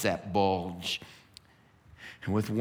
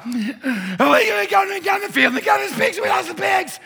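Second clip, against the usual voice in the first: frantic, very high, louder babbling; panicked herders; 9 dB louder than usual. Acted. Young herders telling the town they lost the pigs, frantic. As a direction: blurt it out breathless with panic.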